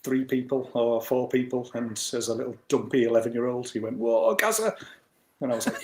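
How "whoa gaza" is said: high-pitched retold exclamation of amazement; impressed cheer. Bobby Charlton cheering a young player's dribble, impressed. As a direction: call it out high, like an admiring onlooker.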